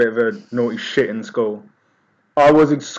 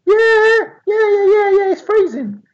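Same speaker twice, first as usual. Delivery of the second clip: put-on much higher squeaky kids chorus; kids agreeing. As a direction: squeak it like eager younger kids. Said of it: "so they're like".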